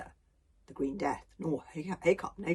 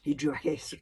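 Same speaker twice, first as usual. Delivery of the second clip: usual speaking voice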